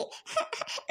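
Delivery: soft unvoiced giggle; breathless giggle. Real, not acted. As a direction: giggle quietly, a little shy. Giggling at her own feelings.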